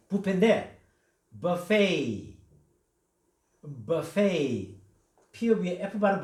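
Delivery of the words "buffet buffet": buffet said "buh-FAY", with no t sound; silent final t. Shown as a demonstration. Teacher showing the class buffet, French-style without the t. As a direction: say buffet ending in an open "ay", drop the t. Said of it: "T가 발음이 안 나죠".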